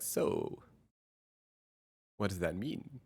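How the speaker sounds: hushed, probing counselor question; therapist voice. Retold or imitated. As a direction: ask it softly like a therapist. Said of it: "I'm like the unlicensed therapist".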